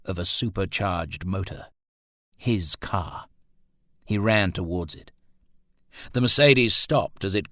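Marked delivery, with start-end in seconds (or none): none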